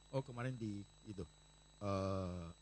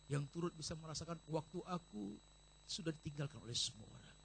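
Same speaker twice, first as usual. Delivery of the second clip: normal talking voice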